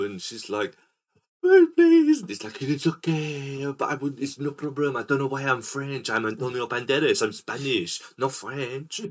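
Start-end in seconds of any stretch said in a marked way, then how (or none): 1.4-2.2 very high, loud mock plea; mock begging. Kidnapped woman to the vampire master, hammed-up begging. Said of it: "begging for help". 2.6-9.0 put-on botched Spanish accent slipping into French; hammed-up, badly faked Spanish accent, raised pitch. Antonio Banderas soothing his victim, bad Spanish accent slipping French. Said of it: "I can't do a Spanish accent".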